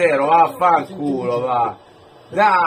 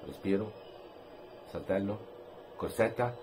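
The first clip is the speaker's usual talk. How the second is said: hushed tense; 8 semitones lower, 17 dB quieter than usual. Real, not acted. Narrating penalty run-up tensely.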